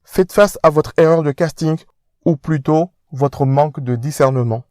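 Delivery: pompous aristocrat lecturing; posh fiancé scolding. Acted. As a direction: talk down to him.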